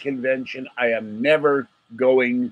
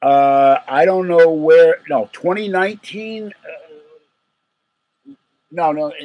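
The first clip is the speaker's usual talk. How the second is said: loud flustered stammering; frazzled and overwhelmed; 6 semitones higher, 9 dB louder, slower than usual. Real, not acted. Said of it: "I'm frazzled".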